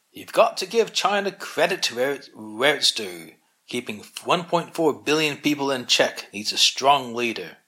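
put-on thick Scottish accent. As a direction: retell a friend's words with a mild Scottish accent. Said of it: "in a thick Scottish accent".

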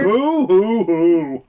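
slow, mocking boo-hoo sobs; sarcastic fake weeping; fake crying. Mocking Edmund missing his father.

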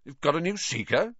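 mildly surprised, sidetracked; distracted. A narrator's reading. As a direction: ask it, thrown off the argument.

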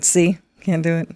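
ordinary talk, nothing marked

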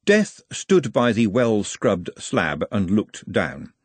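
ordinary delivery, nothing marked

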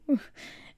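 breathy high laugh; short laugh. Real, not acted. Remembering begging the nurse, a breathy laugh. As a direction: laugh at yourself.

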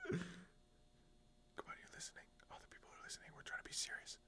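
urgent whisper. Real, not acted. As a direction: whisper it so nobody hears. Hushing friend, keep it serious.

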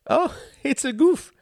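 high, laughing, happy German voice. A narrator's reading. Klaus laughing off Tom's question, high and happy. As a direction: bubble over with a giggle. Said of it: "said Klaus happily".

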